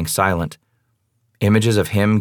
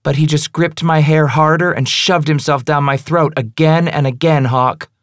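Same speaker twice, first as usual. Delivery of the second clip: taunting woman; 7 semitones higher than usual. Acted. Female character, cruel confession.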